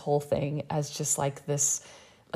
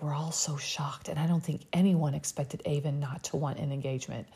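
whispered mock of the show host. Host mocking Jesse's dramatic announcement in a whisper. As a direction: breathe it like a gossip secret. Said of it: "He's like".

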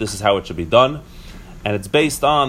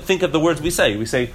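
usual speaking voice

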